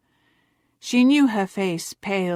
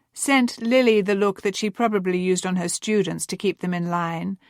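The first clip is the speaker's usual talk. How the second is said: ordinary delivery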